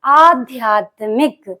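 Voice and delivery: very slow, careful आध्यात्मिक; correct pronunciation. Shown as a demonstration. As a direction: spell out आध्यात्मिक by its sounds. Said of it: "सही शब्द क्या होता?".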